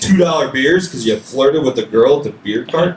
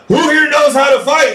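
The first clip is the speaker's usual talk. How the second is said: high, louder call to the crowd; announcer calling out; 11 semitones higher, 3 dB louder than usual. Retold or imitated. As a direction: work the crowd like an emcee. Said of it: "He goes".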